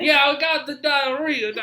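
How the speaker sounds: slow, high, loud mock Southern drawl; hammed-up Southern accent; Southern soldier. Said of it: "And they're like".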